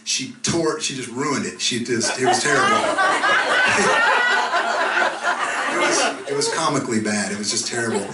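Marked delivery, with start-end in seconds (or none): 2.8-5.7 a squealed burst of laughter; high squealing laugh. Real, not acted. Comic laughing at the emcee's awful singing. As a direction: laugh high at how bad it was.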